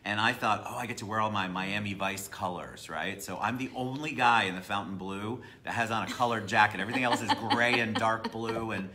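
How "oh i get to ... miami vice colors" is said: usual speaking voice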